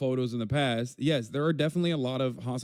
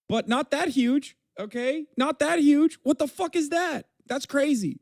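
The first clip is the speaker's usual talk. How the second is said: much higher and louder, disbelieving; incredulous outburst; 14 semitones higher, 6 dB louder than usual. Real, not acted. Streamer losing it over a photo of huge quads, incredulous high shout. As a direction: shout it like you can't believe your eyes. Mocking the lighting excuse, incredulous.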